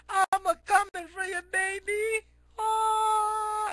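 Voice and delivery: very high, drawn-out mock wail; sing-out squeal trailing into a long "oh"; falling falsetto cry. Neo leaping off a tall cliff, squealed falsetto cry. Character yells jumping off cliff.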